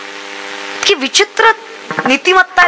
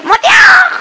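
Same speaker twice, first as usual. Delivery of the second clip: very high, loud calling shout; calling a dog; 13 semitones higher, 4 dB louder, much slower than usual. Acted. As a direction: holler shrilly for the dog, acted. Wife calls out to the dog. (कुत्र्याला मारलेली उंच, जोरदार हाक)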